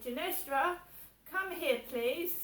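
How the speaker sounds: put-on loud, high call, talking down to a child; mimicking mum calling her daughter in loudly; bossy mum calling. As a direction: call her in like she's a toddler. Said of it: "I get talked to like I'm two years old".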